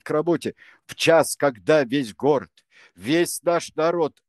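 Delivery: loud accusing rant at the staff; raising his voice. A narrator's reading. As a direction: build an indignant official speech. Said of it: "Он всё возвышал голос".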